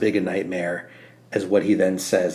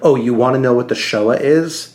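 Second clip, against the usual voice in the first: louder, higher, hammed-up challenge to the reader; mock author voice; 5 semitones higher, 8 dB louder than usual. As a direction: throw it out like a mock dare. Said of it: "[name] took a moment to be like".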